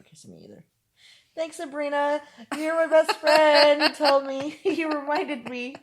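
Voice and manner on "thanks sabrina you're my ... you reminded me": high laughing gush; giddy, squealing through laughter. Real, not acted.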